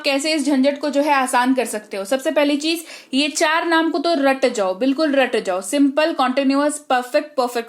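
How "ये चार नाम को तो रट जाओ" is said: ordinary talk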